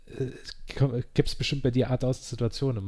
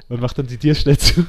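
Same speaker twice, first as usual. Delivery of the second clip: laughing; 3 semitones higher, 9 dB louder than usual. Real, not acted. Embarrassing toilet story, cracking up.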